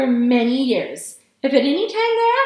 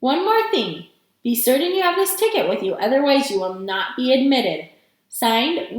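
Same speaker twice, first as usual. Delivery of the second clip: ordinary delivery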